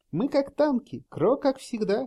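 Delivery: high, light voiced player; voiced high and quick; high character voice. A narrator's reading. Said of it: "говорил Рейники".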